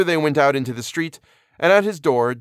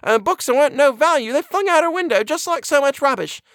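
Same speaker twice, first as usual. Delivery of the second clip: very high, louder flustered housekeeper; breathless exclamation; 5 dB louder than usual. A narrator's reading. Housekeeper panting upstairs behind the doctor, breathless exclamation. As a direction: exclaim it in a high, agitated put-on voice. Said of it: "she exclaimed breathlessly".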